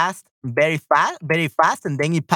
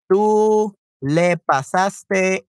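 very slow, drawn-out teaching delivery; slow example; 3 semitones higher, much slower than usual. Shown as a demonstration. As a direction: stretch each word out for learners. Slow example sentence for students.

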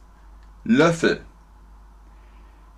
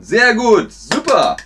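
high, loud burst of approval; excited praise; 9 semitones higher, 5 dB louder than usual. Real, not acted. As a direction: cheer the learners on for getting it. Lobt die richtige Antwort. (begeistertes, lautes Lob)

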